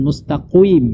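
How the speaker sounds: "mustaqim" ending in "qu-im" with a w glide; over-thicken the q of "mustaqim"; rounded glide inside "mustaqim"; wrong heavy qaf. Shown as a demonstration. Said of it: "bukan mustaqim".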